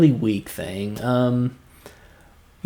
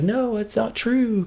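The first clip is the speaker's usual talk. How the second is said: higher, slow, hurt disbelief; pained denial; 10 semitones higher, 3 dB quieter, slower than usual. Retold or imitated. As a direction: plead it, refusing the truth. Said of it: "He's like".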